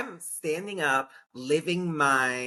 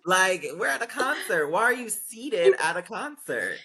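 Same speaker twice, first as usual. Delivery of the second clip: high, exasperated mock scolding; retold indignation; 8 semitones higher than usual. Storyteller replaying a scolding of a seated concertgoer, indignant. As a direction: challenge them in disbelief, pitch climbing.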